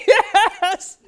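high, loud, laughing yes; kids cheering. Retold or imitated. Boys cheering at the Disneyland news, loud and laughing. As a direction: cheer like a thrilled kid.

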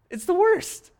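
high, loud exasperated cry. Acted. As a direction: wail it like the worst thing ever. Exasperated refrain after mock outrage.

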